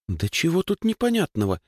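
high-pitched indignant retort; indignant girl's question, pitched well up. A narrator's reading. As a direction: protest that it's perfectly obvious. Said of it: "возмутилась Даша".

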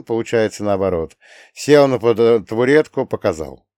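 ordinary delivery

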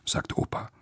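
usual speaking voice

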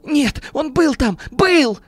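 high, slow insistent wail, rising to a shout; hysterical. A narrator's reading. Businessman insists someone was at the door, in hysterics. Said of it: "продолжал биться в истерике Бирюков".

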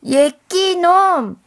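high, loud, slow rebuke; loud scolding. Acted. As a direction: scold the thief at full volume. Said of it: "할머니도 따라했어요".

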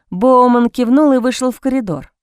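usual speaking voice

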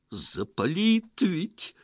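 slow, high-pitched dismay; alarmed gasp. A narrator's reading. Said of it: "ахнул Петро".

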